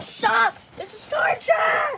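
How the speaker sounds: high slow pained yell; outraged shout. Pretend. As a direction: wail it in protest. Patient protests painful treatment.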